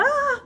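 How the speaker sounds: high sharp scream of shock; sudden cry. Retold or imitated. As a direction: let out a short startled yell. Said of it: "il commence à crier".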